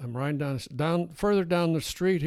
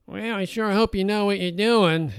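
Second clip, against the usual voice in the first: put-on a touch of a Brooklyn accent, higher and louder; 6 semitones higher, 6 dB louder than usual. Passer-by woman mocks a cyclist fixing a flat, Brooklyn accent. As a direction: lightly mimic a Brooklyn passer-by. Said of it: "a, uh, Brooklyn type accent".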